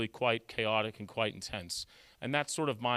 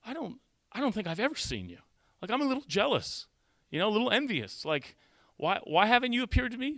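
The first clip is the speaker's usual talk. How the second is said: pitched way up, a half-playful complaint; retold prayer, pitched high and sulky; mock jealous plea to God; 9 semitones higher than usual. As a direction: ask God high and hurt, like a left-out kid. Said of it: "and I was like".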